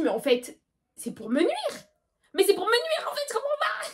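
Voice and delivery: exasperated outburst. Retold or imitated. Girl fed up with her dead phones, rising exasperated whine. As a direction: whine it out, climbing to a squeak.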